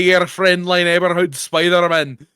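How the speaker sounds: slow, botched Scottish that drifts broadly European; bad Scottish accent. Spider-Man greets a birthday kid, bad Scottish accent. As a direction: stretch it out in failed Scottish. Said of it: "sounded like a broad European accent".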